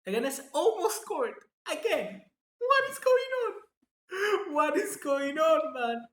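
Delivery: wailing at the screen in disbelief; exasperated disbelief. Real, not acted. Streamer to viewers as Leganés nearly scores again: high, slow exasperated wail.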